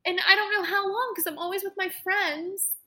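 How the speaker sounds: half-crying as she retells it; choked-up plea to her mom; tearful. Retold or imitated. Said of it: "started bawling my eyes out".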